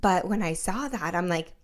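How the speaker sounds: ordinary talk, nothing marked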